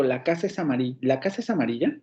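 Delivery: "¿La casa es amarilla?" rising at the end; as a question. Shown as a demonstration. Teacher asks the class the sentence as a question, rising. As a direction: ask it, lift on "amarilla". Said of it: "Cuando yo les pregunto".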